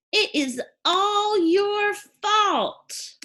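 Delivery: high, slow, drawn-out accusation; hammed-up blame, stretched out high; mock blaming. Teacher acting an example sentence for class, mock accusing.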